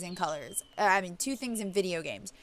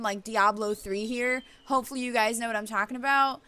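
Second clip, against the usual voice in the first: ordinary talk, nothing marked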